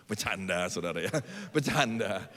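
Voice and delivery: chuckling through a joke; laughing it off. Real, not acted. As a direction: laugh as you take it back. Laughing at his own joke.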